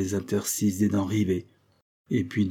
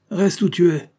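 high, loud command; peremptory skipper; captain's order; 10 semitones higher, 8 dB louder than usual. Acted. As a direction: stop the man with a shout. Captain orders man to stay.

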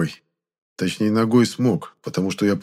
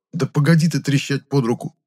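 higher, annoyed protest; exasperated telling-off; indignant; 6 semitones higher than usual. A narrator's reading. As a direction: brush off an interruption crossly. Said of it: "возмутился я".

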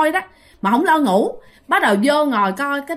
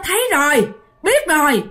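eerie reply; 4 semitones higher than usual. Retold or imitated. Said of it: "nó nói".

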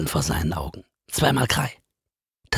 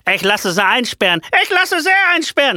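shrill, much higher, loud outraged yell; furious yelling teacher; 13 semitones higher, 6 dB louder than usual. Acted. The teacher yells at his double, much higher and louder. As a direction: bellow insults at the impostor. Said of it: "schreit das Echo".

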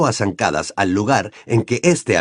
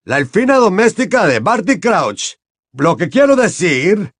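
higher, grave official tone; lightly voiced Mr Diggory; 8 semitones higher, 3 dB louder than usual. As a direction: Mr Diggory awkwardly naming the elf's owner.

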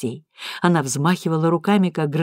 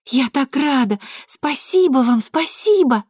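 squealing with joy; 8 semitones higher, slower than usual. Acted. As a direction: squeal it, overjoyed little girl. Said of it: "девочка завизжала от радости".

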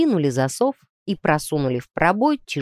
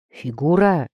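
plain reading voice

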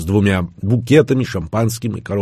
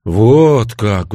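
drawn rise-and-fall of surprise, louder; surprised; 3 semitones higher, 6 dB louder than usual. A narrator's reading. Son hears father's KGB story, surprised. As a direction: react with open surprise. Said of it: "удивился я".

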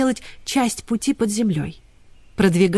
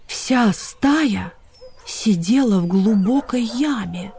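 usual speaking voice

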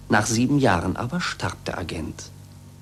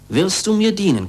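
agent's demand; 6 semitones higher, 4 dB louder than usual. A narrator's reading. Narrator voices tyrant's agent.

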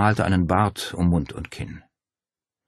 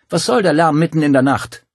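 higher, louder angry complaint; indignant scolding; 10 semitones higher, 8 dB louder than usual. A narrator's reading. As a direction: tell them off for the noise. Woman scolds night visitors.

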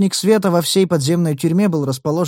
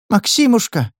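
joyful cry; 8 semitones higher than usual. Acted. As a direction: cry out in relief and joy. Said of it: "под радостный крик Наты".

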